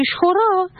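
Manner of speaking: "шхура" with no vowel between ш and х; покоящаяся шва. Shown as a demonstration. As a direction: run ш straight into х in "шхура". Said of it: "как если бы это был шванах". (немая шва в «шхура», без гласного после «ш»)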